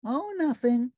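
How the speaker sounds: high, sweet, slow big-bird voice; gentle, innocent giant bird. A narrator's reading. Narrator voicing Big Bird calling Ernie on the phone, high and sweet.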